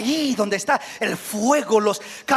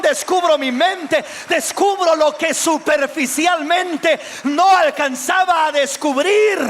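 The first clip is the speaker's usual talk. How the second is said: higher, louder cry of repentance; anguished lament; 8 semitones higher, 4 dB louder than usual. Acted. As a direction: cry out your sinfulness to the Lord. Predicador actúa lamento de Isaías. (lamento angustiado, más agudo y fuerte)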